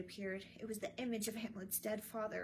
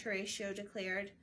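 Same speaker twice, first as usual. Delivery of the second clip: usual speaking voice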